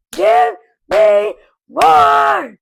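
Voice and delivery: sudden all-out excited shout, drawn out; really loud. Real, not acted. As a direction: yell it out, stretching each word. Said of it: "I just got really loud".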